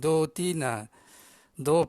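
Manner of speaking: ordinary talk, nothing marked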